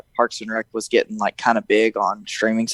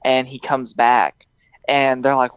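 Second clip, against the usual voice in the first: ordinary talk, nothing marked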